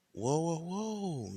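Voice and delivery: long, high, drawn-out whoas; placating interjection. Acted. Breaking up Michael and the queen, slow high placating. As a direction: stretch it out to halt an argument. Stepping between two arguing characters.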